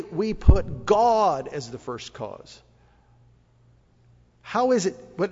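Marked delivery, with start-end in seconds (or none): 0.0-2.6 slow, weighty stress on "God"; emphatic declaration. Acted. Israel declaring before the temple, emphatic. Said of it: "they would say emphatically".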